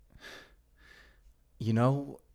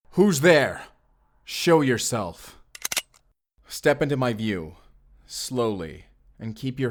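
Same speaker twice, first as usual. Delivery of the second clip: sharp call-out; 3 dB louder, slower than usual. Acted. Guard challenging a hidden intruder, loud and alert. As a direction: demand they show themselves.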